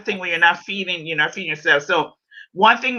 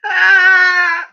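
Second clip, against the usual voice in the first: excited shout; 9 semitones higher, 5 dB louder than usual. Real, not acted.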